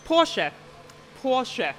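Porsche in two syllables, POR-shuh; German pronunciation. Shown as a demonstration. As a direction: sound the final E of Porsche. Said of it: "we always pronounce the E at the end".

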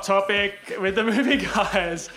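big hyped show intro; announcer intro. Acted.

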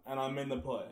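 ordinary delivery

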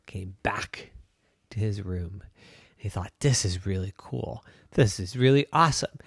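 soft whisper to settle the story; hushed. A narrator's reading. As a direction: wind down gently. Gentle ending, calm thoughts.

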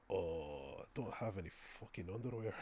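put-on low, very quiet mumbled Irish accent; imitated Irish coworker. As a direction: imitate an Irishman, soft and quick. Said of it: "one of the Irish guys".

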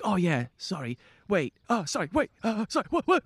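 rising, flustered pupil stumbling on words; flustered kid. Retold or imitated. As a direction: play the kid who can't stop saying it. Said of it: "he's like".

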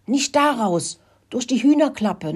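high-pitched warning; urgent squeak. A narrator's reading. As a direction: squeak out hurried directions. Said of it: "fiebte er Rudi zu".

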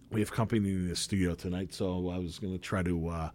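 hushed, quick and low; quiet. Real, not acted. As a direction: keep it down for a sleeping guest. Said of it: "keep my fucking voice down".

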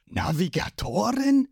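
confused. A narrator's reading. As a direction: ask in bewildered disbelief. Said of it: "Zorro, der immer noch nicht ganz versteht".

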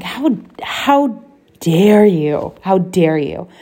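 rising indignant outrage; how dare you, said with fresh real anger. Real, not acted. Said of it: "I'm feeling anger towards that kid".

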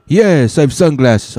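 indignant, puzzled Frenchman with a thick put-on accent. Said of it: "this guy had a French accent".

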